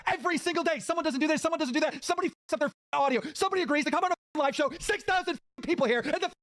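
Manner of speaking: high-pitched, piling-up grievances; exasperated rant. Real, not acted. Host defends himself against forced-drama claims, exasperated rant. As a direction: rattle off complaints in real exasperation.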